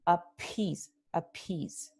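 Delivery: "appease" slowly, with a puff on the p; aspirada. Shown as a demonstration. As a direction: breathe out the p into stressed "-pease" in "appease". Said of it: "tenemos la aspiración". ("appease" con p aspirada)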